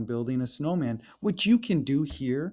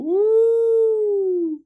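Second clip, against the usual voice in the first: high loud whoop; excited cheer; 9 dB louder than usual. Acted. Teacher cheering the sled ride, high whoop.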